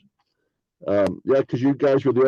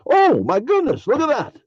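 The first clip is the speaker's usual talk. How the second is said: 2 much higher, louder oh my goodness; 3 thrilled to find it; 4 a burst of mock delight; 1 delighted surprise; 13 semitones higher, 4 dB louder than usual. Delighted discovering poem.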